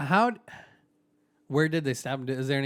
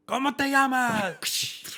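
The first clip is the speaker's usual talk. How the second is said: high, drawn-out demand; stretched-out high-pitched question in Spanish; mock interrogation; 12 semitones higher, much slower than usual. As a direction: play a hammed-up interrogator, slow and high. Acting slave being whipped, demanded name.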